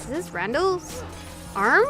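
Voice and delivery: usual speaking voice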